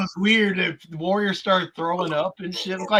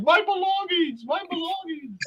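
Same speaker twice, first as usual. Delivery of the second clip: mock yelling; 12 semitones higher than usual. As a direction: wail it in mock outrage, pitched way up. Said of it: "he's like yelling".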